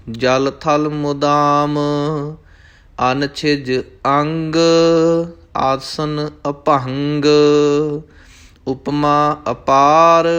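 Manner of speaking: slow, sustained devotional chant; scripture recitation. Acted. Teacher reciting scripture for learners, slow chanting. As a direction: recite measuredly, drawing out each line's last syllables.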